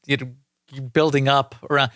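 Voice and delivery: usual speaking voice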